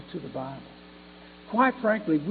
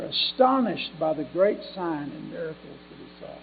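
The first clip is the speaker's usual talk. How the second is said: louder, emphatic pulpit reading; Scripture reading; 3 semitones higher, 9 dB louder than usual. Acted. Preacher reading Acts chapter eight to the congregation, emphatic Scripture reading. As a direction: read scripture out to the congregation, stressed words punched up. Reads the Scripture passage aloud.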